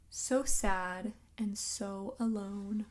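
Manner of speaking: usual speaking voice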